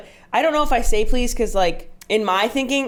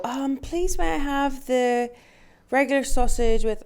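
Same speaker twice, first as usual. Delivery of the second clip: higher, slow mock British order; British accent; 5 semitones higher, slower than usual. Imitating teammates' polite British ordering, slow and high. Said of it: "I don't know what accent that was".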